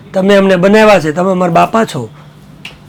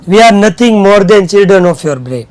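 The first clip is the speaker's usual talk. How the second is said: slow reading aloud; much slower than usual. Shown as a demonstration. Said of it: "teacher reads English line slowly".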